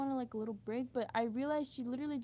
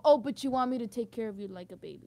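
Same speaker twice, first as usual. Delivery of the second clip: ordinary talk, nothing marked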